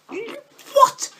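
real shocked shout; stunned disbelief. Real, not acted. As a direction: shriek in disbelief at the goal. Opponent scores from kickoff.

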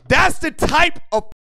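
loud, high hype; excited approval. Real, not acted. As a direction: praise it with rising enthusiasm. Delighted that entry includes video.

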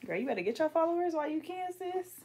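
sing-song tease from up high; high playful nudge to grow followers; high teasing. Real, not acted. Teasing friend about followers.

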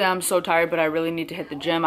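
normal talking voice

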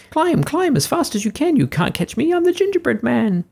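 very high, squeaky taunting chant; sing-song little cookie teasing from treetop; gingerbread man voice. Acted.

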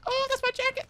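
squeaky high exclamation; playful high squeal; excited. Pretend. Repeats jacket line playfully.

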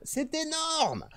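very high, very slow "énorme" stretched out; exaggerated amazement. Streamer hamming up awe at the database, high and slow. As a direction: hoot "c'est énorme" way up high and drawn out.

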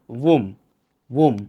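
womb ending on "m", no b; correct pronunciation. Shown as a demonstration. Teacher repeating the rule, correct pronunciation. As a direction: end womb on a hummed "m". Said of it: "The b sound at the end will be silent".

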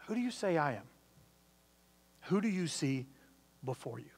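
Jesus quietly pressing the disciples; dropped to hush. Retold or imitated. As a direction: let it fall quiet and personal. Question after build, drops suddenly.

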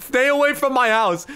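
high mock yell; mock angry warning. Gamer voicing the hater's chat, mock angry warning. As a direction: imitate an angry player warning someone off.

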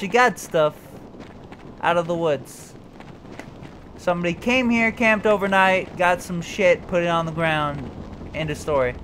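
ordinary talk, nothing marked

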